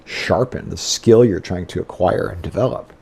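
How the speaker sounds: ordinary talk, nothing marked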